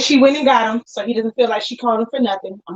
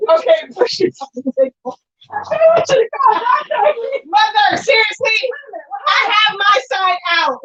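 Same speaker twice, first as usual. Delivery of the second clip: straining high exclaims; 8 semitones higher than usual. Real, not acted. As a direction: yell while hauling something heavy. Straining while lifting with mother.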